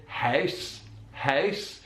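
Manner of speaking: "house" with a closed, short Belfast ow; Belfast diphthong. Shown as a demonstration. Teacher imitating an actor's Northern Irish vowel, closed diphthong. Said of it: "slightly more closed, shorter sound".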